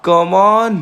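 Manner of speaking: normal talking voice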